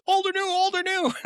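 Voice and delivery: jittery squeal, hammering the question; frantic Monica. Retold or imitated. Teller mimics Monica begging to know, high frantic squeal.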